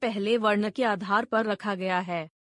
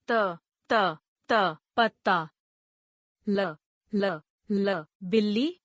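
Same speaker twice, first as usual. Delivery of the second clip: syllable-by-syllable spelling drill. Shown as a demonstration. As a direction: sound out each letter, then blend the word. Spells example words letter by letter.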